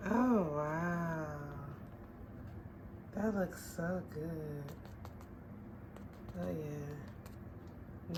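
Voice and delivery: quiet awe; hushed admiration. Real, not acted. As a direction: murmur in appreciation.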